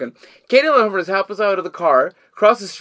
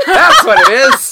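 laughing read-out; 12 dB louder, slower than usual. Retold or imitated. Reading autograph, laughing.

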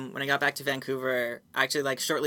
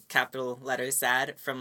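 usual speaking voice

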